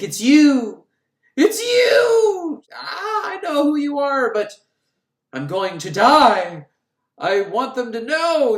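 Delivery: high, loud crying shout, then slow fading; hammed-up dying revelation. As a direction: overact a dying woman's realization. Dying Tanner's revelation, retold.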